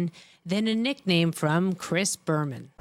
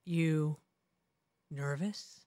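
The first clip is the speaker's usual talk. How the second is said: low, quiet imitation with a long pause; deep voice; 4 semitones lower, 8 dB quieter, much slower than usual. Teasing a first-time anchor, imitated deep and quiet. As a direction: drop it deep and soft, mock-ominous. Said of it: "deep voice".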